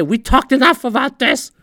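mock yell at press. Owner shutting down reporters, mock yell. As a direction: bark it back at the press. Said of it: "yelling at the press".

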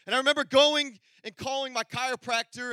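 normal talking voice

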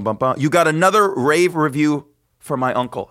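ordinary talk, nothing marked

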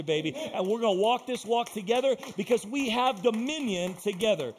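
loud, high, rousing preacher's call; full-force preaching. Acted. Preacher urging men and women to stand together, loud rousing call. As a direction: lift the room, driving and loud.

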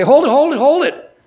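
sudden high, loud "hold it"; calling out to stop. Acted. Buyer stopping the eager salesman, loud high call. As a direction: throw up a hand and halt him, loud and high.

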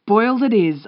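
high, loud, eager agreement; excited. A narrator's reading. Host takes the guest's zucchini order, excitedly. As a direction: confirm the order excitedly, lifting pitch and volume. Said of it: "I say excitedly".

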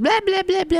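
put-on high-pitched blah-blah baby noises; mock infant babbling, way up high; imitated baby babble. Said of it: "he's in a really chatty phase".

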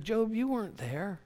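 high-pitched mock-divine correction of Job; God. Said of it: "He's like".

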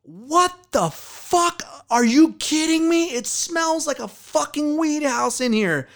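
high, drawn-out outraged mom; mock a mother's stunned, high-pitched outburst; furious mom. Angry mom opens the door.

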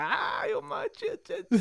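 hammed-up sobbing shrieks; a kid yelping under a beating; mock crying yelp. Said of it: "I'm all crying and shit".

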